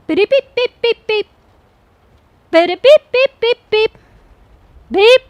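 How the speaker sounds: high, loud robotic beeps; beeping robot sounds. Shown as a demonstration. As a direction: beep like a machine, high and bright. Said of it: "Testing or beep?".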